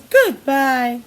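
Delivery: ordinary delivery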